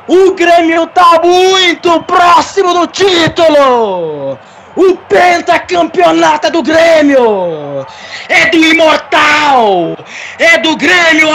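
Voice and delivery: slow, shouted stadium narration; title-winning goal call. Acted. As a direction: roar out the championship goal. Live goal call for Grêmio. (narração épica de título)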